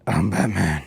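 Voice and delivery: low breathy Batman rasp. Retold or imitated. Suggesting a name to viewers, low breathy Batman. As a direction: growl the name like Bale's Batman.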